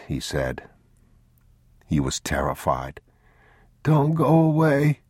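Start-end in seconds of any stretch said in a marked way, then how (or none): 3.8-5.0 put-on high, slow child's plea; a sick boy begging; pleading boy. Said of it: "the boy said".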